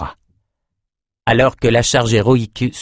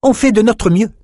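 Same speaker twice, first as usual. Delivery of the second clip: higher, slightly louder protest; objecting; 12 semitones higher, 4 dB louder than usual. A narrator's reading. Peretta objecting to Ambre, higher protesting voice. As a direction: push back on the criticism. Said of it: "objecta Peretta".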